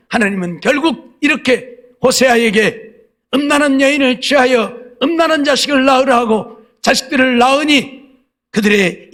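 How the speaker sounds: high, drawn-out chanting crescendo; full-force preaching. Real, not acted. Preacher recounting prophets sent to Israel, full-force preaching. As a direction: build insistently through each repeated phrase.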